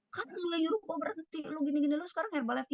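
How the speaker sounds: high-pitched mock scolding; menirukan marah. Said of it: "Wah, dia langsung marah, maki-maki saya". (menirukan teman marah-marah dengan nada tinggi)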